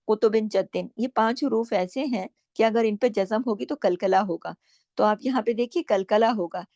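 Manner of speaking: ordinary talk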